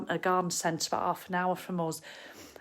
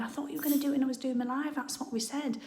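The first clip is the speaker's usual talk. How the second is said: higher, rushed mock nag; angsty nagging; 6 semitones higher, faster than usual. Imitating herself nagging her husband about the presents, fast and mock-irritated. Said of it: "if I start getting angsty going".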